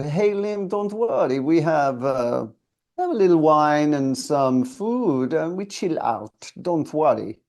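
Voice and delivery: put-on Italian producer calming a tired singer, relaxed Italian accent. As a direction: reassure in a chilled Italian accent. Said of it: "He had a, a lovely Italian accent".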